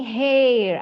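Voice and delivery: loud, long "hair" with clear h; sounded-h pronunciation. Shown as a demonstration. Teacher contrasting it for students, emphatic h. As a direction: push the h out on "hair". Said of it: "ada H-nya".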